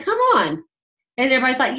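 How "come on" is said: excited. Retold or imitated. Coaxing recruit to join.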